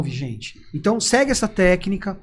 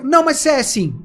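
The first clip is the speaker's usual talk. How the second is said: higher mock protest; heated insistence, imitated; 6 semitones higher, 3 dB louder than usual. Unbalanced person insisting on an accusation, heated mock retort. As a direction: act out a stubborn, unbalanced arguer. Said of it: "Aí pronto, apelou".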